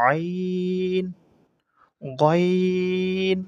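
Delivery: each letter name drawn out very slowly; recited Arabic alphabet. Shown as a demonstration. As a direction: chant the letters long and even. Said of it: "এইভাবে উচ্চারণ করতে হবে".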